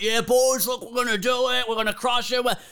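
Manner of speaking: high, pumped-up locker-room boss rallying the team; hyped alpha bro. Retold or imitated. Cocky boss firing up the team, high and pumped. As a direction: hype the boys like a cocky manager. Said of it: "the eighty-seven I Q guy who's up there who's like".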